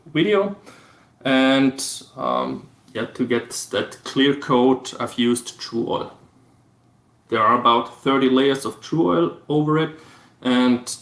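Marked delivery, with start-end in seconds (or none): none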